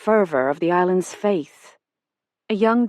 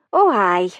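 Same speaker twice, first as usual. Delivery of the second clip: put-on Highland accent; 5 dB louder than usual. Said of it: "the accent pure Highlands".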